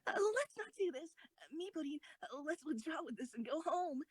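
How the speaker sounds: high, frightened plea to leave; scared pleading. Acted. Commander begging friend to withdraw, high scared plea. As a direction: beg to back out and go home. Wants to flee after danger.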